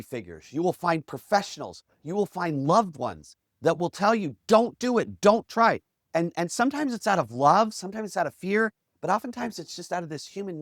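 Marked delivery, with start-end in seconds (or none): 4.5-5.8 mock urgent discouragement, raised and louder; urgent warning. Loved ones discouraging a dreamer, urgent warning. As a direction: warn them off, higher and punchy.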